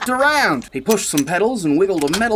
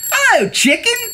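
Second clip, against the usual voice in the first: cheerful duck greeting. A narrator's reading. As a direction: sing out a friendly hello as Duck.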